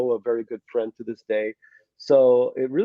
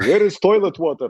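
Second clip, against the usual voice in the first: put-on high, loud Russian-accented parent; comic Russian immigrant accent; thick Russian accent; 6 semitones higher, 6 dB louder than usual. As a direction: imitate your parents' thick Russian English. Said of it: "the Russians have a very thick accent".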